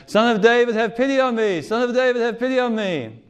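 high, loud desperate plea; crying out. Retold or imitated. Blind beggar at the roadside calling to Jesus, loud desperate cry. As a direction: call out to be heard over a crowd. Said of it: "He's crying out".

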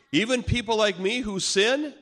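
high, loud testing question; challenging question. Retold or imitated. Big man testing Miguel's claim, challenging. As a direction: throw it at him as a challenge.

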